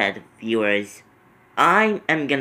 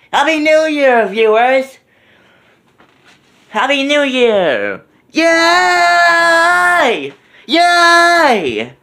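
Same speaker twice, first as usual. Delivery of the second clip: excited shout; 12 semitones higher, 5 dB louder, slower than usual. Real, not acted. Celebrating midnight.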